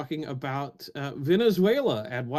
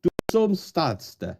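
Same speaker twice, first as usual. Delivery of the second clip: put-on Old Norse verse in a Finnish-sounding accent; 2 semitones higher, slower than usual. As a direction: declaim the ancient lines slowly, like a Finn attempting Old Norse. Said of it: "you sounded mostly Finnish".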